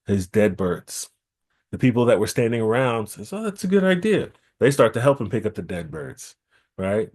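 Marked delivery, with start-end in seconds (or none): 3.3-4.3 high approving crowd. Acted. Onlookers approve the plan.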